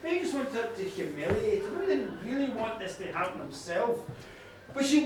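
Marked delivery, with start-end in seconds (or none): none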